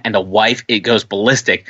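ordinary talk, nothing marked

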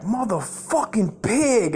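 high, drawn-out mock curse; retold angry yell. Relatives waiting on the drugs curse uncle, mock angry yell. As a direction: imitate furious relatives, stretching the words high. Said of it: "and they're like".

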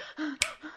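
quick, soft crying gasps, acted out; mock hyperventilating gasp. Shown as a demonstration. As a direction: imitate gasping too hard to talk. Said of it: "like hyperventilate and you can't even talk".

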